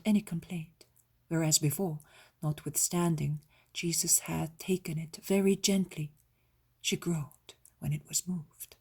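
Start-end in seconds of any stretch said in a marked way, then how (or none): none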